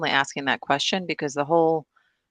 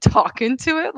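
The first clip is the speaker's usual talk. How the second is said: usual speaking voice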